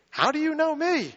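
high, amazed asking; astonished question. Acted. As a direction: gasp out the question in wonder.

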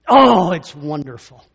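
loud, beaming exclamation; loud happy outburst; delighted. Real, not acted. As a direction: burst out with joy at the memory. Relief of finding the way home.

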